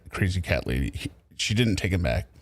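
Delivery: normal talking voice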